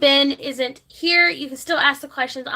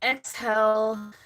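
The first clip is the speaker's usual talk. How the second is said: guided breathing; 2 semitones lower than usual. Acted. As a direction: guide viewers through each breath, unhurried. Leads a breathing exercise.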